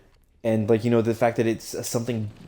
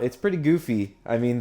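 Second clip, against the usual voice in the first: ordinary talk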